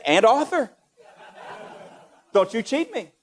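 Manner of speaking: high, laughing brag; laughing boast. Retold or imitated. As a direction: tease her with a laugh. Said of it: "I said".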